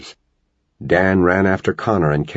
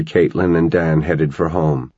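ordinary delivery, nothing marked

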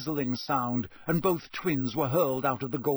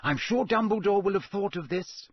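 warning; 7 semitones higher than usual. A narrator's reading. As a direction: caution them, a little prim. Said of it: "said Hermione warningly".

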